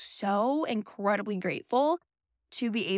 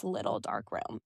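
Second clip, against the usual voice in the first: hushed dark; 12 semitones lower, 7 dB quieter than usual. Acted. To viewers, sinking low and hushed on dark room. Describing being stuck in her head.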